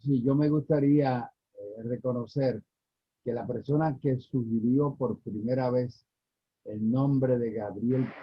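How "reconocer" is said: normal talking voice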